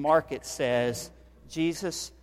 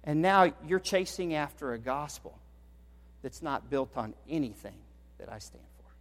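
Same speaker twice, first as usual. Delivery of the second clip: soft, falling to a whisper; pleading quietly as the apostle; hushed Paul; 10 dB quieter than usual. Acted. Paul to the church, quiet and wounded.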